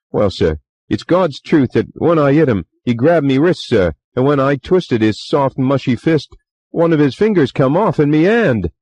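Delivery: put-on chatty young bellboy, eager to please; Cockney bellboy. A narrator's reading. Bellboy Thomas voiced.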